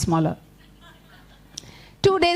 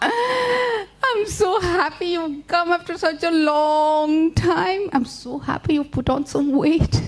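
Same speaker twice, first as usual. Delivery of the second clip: sly spider host; 6 semitones higher, slower than usual. Acted. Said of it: "He says".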